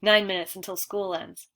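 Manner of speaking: ordinary delivery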